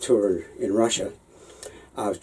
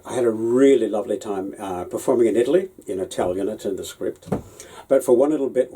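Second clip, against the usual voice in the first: ordinary talk, nothing marked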